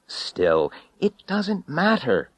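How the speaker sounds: a touch of cross impatience, drawn out; mildly cross, dismissive. A narrator's reading. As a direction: let the irritation slip out slowly. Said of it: "I said crossly".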